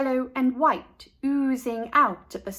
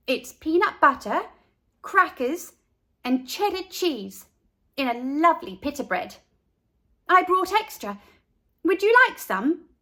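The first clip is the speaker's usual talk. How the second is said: put-on high, bright eager kid; proud girl voice; 6 semitones higher, 4 dB louder than usual. A narrator's reading. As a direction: show off your creation. Carla proudly describes lunch.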